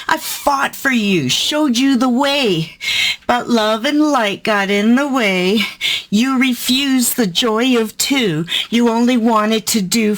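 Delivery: ominous spoken-word reading; dramatic poem reading. Acted. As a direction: perform it like a sinister spellcaster. Reads poem Doll Spell.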